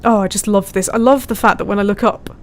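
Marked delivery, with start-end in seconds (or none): none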